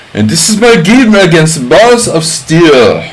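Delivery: hammed-up imitation of Roman lecturing the chess club in his Georgian accent; imitating Roman's accent. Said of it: "I can't even do his accent".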